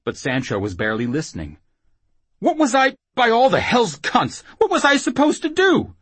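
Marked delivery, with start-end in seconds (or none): 2.4-5.9 high, loud panicked babble; frantic rambling. Acted. Whoremaster barely listening to his attacker, frantic rambling. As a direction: ramble on loudly in a high, helpless voice. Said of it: "he rambled".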